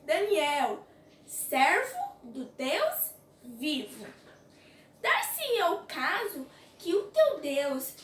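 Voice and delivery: ordinary talk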